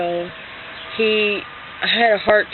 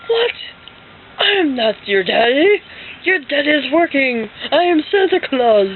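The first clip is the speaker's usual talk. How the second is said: high, slow, emphatic mock denial; dad as Santa; 6 semitones higher, 3 dB louder than usual. Dad denies being daddy to daughter, hammed-up Santa insistence. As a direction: insist it slowly in a put-on Santa voice. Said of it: "And my dad's like".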